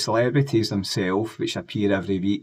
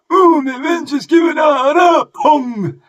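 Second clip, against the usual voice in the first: much higher, slow, shouted mock rant; 12 dB louder, slower than usual. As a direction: scream it like a furious caricature. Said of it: "portrayed as being dead angry".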